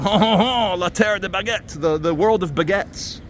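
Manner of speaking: mock French tourist guide; lightly put-on French voice joking with French kids. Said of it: "and I was like".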